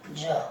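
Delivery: short, low, soft "ज". Shown as a demonstration. Teacher answering what S sounds like, S as J. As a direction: say "ज" as a lone voiced j sound. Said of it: "एस का उच्चारण ज होता है".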